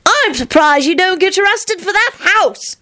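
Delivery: put-on high cheery child voice; cheerful. A narrator's reading. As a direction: tease him brightly. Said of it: "he said cheerily".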